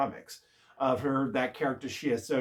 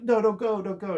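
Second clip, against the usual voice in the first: higher-pitched retold plea; lightly acted alarm, pitched up; mock pleading; 7 semitones higher, 4 dB louder than usual. People beg her not to go into the hex, mock pleading. Retelling onlookers begging her to stop.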